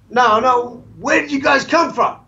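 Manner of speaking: high, incredulous cop voice; a cop who won't take the answer; mock trooper. Trooper doubting the answer, high incredulous mock voice. Trooper repeating his question.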